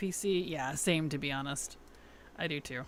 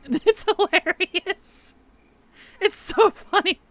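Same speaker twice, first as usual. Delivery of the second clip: laughing; 12 semitones higher, 8 dB louder, slower than usual. Real, not acted. Laughing at a funny quest.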